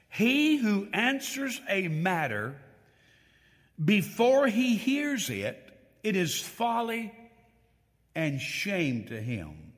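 high, loud and slow, each phrase weighed; forceful scripture reading. Acted. Preacher proclaiming Proverbs to the congregation, loud and slow.

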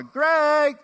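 high, loud call out the back door; imitated mom's shouted summons; mom yelling. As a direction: holler for a child outside. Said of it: "my mom yelled out the back door".

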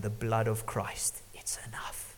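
slow, whispered and reverent; hushed whisper. Real, not acted. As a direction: drop to a whisper to let it land. Sermon climax, hushed delivery.

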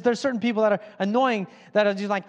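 normal talking voice

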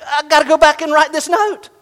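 high, loud burst of sudden realization; excited realization. Retold or imitated. Pastor reliving the moment he understood the text, excited. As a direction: stretch the first words, then hurry on loudly.

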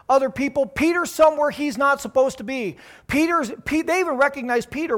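preaching at full force. Acted. Preacher stirring the congregation, loud and fervent. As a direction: paint the scene with rising fervor.

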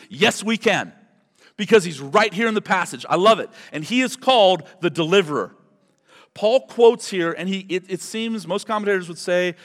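normal talking voice

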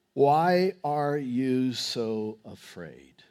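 quiet, deliberate reproach; measured question. Acted. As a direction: draw out each word gravely. Jesus questions disciples, read slowly.

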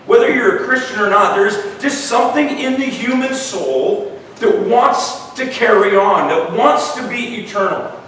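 a touch of rising preacher fervor; preaching. Acted. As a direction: build the sermon with passion. Preaching builds up.

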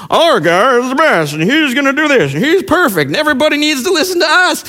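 mocking rally fan. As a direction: lampoon the blind fan's boasting. Mocking fanatical rally supporters.

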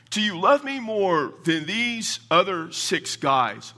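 slow and high, weighting "these other six guys"; pointed question. Retold or imitated. Voices Jesus pointedly challenging Peter.

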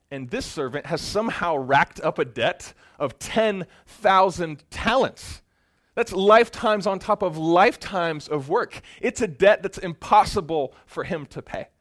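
loud, high, building emphasis; preaching at full force. Real, not acted. Preacher stressing the servant's unpayable debt, loud and rising. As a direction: press the impossible size of the debt.

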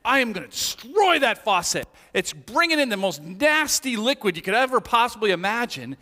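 hammed-up furious vow, high and loud. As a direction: rage theatrically, punching the key words. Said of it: "I'm furious".

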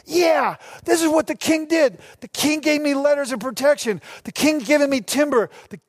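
high-pitched, eager rallying speech; inspiring rally. Retold or imitated. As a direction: fire up a doubting crew. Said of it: "he's inspiring them".

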